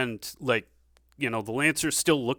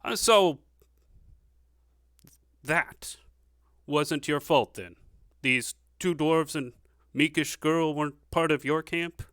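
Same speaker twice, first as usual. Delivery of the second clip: [name] voiced; 4 semitones higher, 5 dB louder than usual. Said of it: "nods, like".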